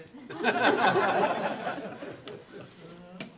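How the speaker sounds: real unvoiced laugh at himself; breathy laugh. Real, not acted. Preacher to the congregation, a real breathy laugh. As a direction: laugh it off, caught out. Laughing at his own conviction.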